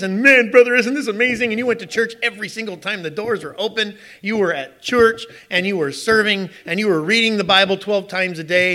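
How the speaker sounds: rising, fervent preaching. Acted. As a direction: build into excited praise. Preaching builds with enthusiasm.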